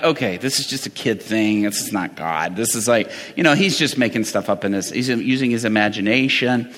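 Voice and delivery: low, muted sceptical aside; doubting to yourself, voice dropped low; dismissive inner thought. Retold or imitated. Retold sceptical inner thought of a dad.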